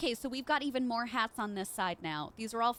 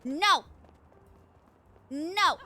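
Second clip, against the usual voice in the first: loud, higher cries of dismay; 5 semitones higher, 10 dB louder, much slower than usual. Real, not acted. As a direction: cry out as it slips away. Losing the feather.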